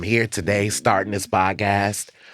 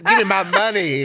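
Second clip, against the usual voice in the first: high, louder joking demand; mock greedy demand; 12 semitones higher, 5 dB louder than usual. Cohost jokes about the customer's note, mock demanding. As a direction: play a greedy server. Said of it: "Like, right".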